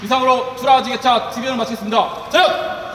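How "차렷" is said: sharp high attention command; drill sergeant. Real, not acted. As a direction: call the line to attention. Formation command at end.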